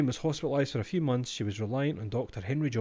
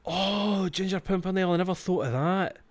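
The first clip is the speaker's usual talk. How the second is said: realisation; 7 semitones higher than usual. Real, not acted. Realising where the word comes from.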